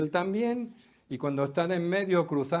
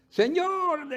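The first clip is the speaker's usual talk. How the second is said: panicked cry; 14 semitones higher, 5 dB louder than usual. Retold or imitated. Disciples wake Jesus in storm.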